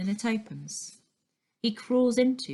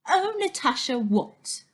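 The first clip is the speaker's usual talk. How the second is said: mocking echo; 6 semitones higher, 4 dB louder than usual. A narrator's reading. Girl mocks Stan's words.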